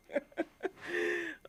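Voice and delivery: real amused laughter; high laugh. Real, not acted. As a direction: laugh fondly about a funny friend. Remembering funny Joe.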